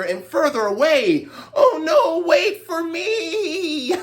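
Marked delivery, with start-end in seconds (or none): 1.5-3.9 high, crying "wait for me", slowing down; mock dismay. Old man wailing after his rolling rice ball, high and slow.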